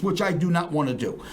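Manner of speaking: ordinary talk, nothing marked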